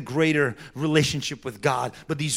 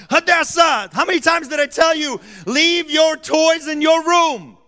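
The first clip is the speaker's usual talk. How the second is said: louder, higher exasperated dad yelling; scolding shout; 12 semitones higher, 9 dB louder than usual. Retold or imitated. Father scolding his daughter about her toys, shouting. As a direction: call her out across the room.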